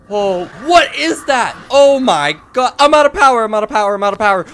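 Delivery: high, loud, frantic cries; panicked shouting. Real, not acted. Player sees creature and loses power, panicked shouting. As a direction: blurt it out in rising alarm.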